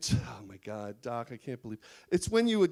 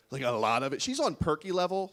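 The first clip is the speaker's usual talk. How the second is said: ordinary delivery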